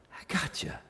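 soft unvoiced whisper of reassurance; whispered I gotcha. Shown as a demonstration. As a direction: breathe it like a secret comfort. Repeated line whispered after plain take.

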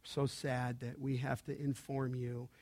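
quiet, slow and calm announcement. Retold or imitated. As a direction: break the sad news gently and slowly. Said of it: "He says very calmly".

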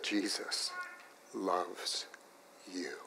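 very soft, slow and tender; hushed, tender declaration. Retold or imitated. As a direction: let each word land gently. Tender climax of the story.

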